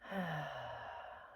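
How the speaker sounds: slow audible exhale, falling away; long sigh. Real, not acted. Meditation guide settling into the seat, long relaxed sigh.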